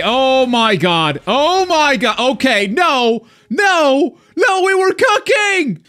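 loud, high outburst with drawn-out words; pissed off. Real, not acted. Gamer losing the raider priority mid-play, pissed off. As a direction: cry out in real, rising frustration. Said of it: "I am so pissed right now".

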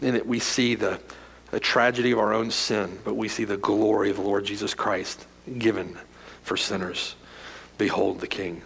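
softly behold the King, reverent preacher; hushed reverence. Acted. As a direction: drop almost to a hush, in awe. Hushed close of the point.

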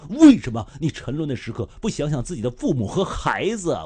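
loud, impassioned reading of the questions; dramatic anchor-style reading of an angry post; reading an angry rant. Retold or imitated. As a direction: declaim each why with rising fury. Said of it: "这真是声嘶力竭".

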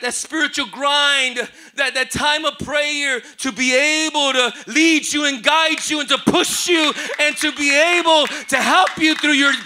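rising preacher's build, loud by the end; preaching. Acted. Leads the sermon.

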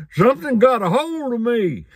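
mock panicked yell. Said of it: "he was just screaming".

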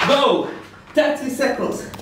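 ordinary delivery

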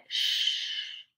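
long voiceless hushing "Sh-"; "Sh-" alone as a held hush; sh sound. Shown as a demonstration. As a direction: draw out "Sh-" before the word. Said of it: "It makes a sh sound".

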